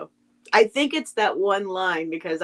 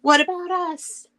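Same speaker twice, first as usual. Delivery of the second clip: higher, lightly voiced chorus of toys; the other toys; 9 semitones higher than usual. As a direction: pipe up as the left-out toys. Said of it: "all the others are saying".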